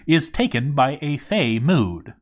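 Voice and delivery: plain reading voice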